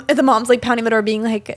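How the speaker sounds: normal talking voice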